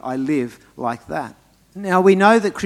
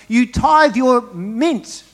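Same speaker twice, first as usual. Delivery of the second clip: high, slow, indignant rebuke; angry Jesus; 8 semitones higher, 5 dB louder, much slower than usual. Acted. Preacher quoting Jesus scolding the Pharisees, indignant. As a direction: draw out each word in raised-pitch reproach. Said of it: "read angry Jesus".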